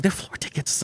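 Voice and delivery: whispered in awe; hushed whisper. Retold or imitated. Friend whispering amazement to teller. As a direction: breathe it like a secret.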